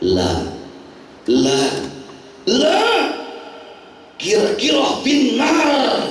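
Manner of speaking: building, emphatic refutation; firm rebuttal. Retold or imitated. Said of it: "langsung Nabi gurunya yang bantah".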